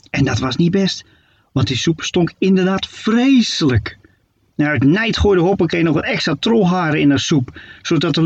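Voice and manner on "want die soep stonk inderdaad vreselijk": usual speaking voice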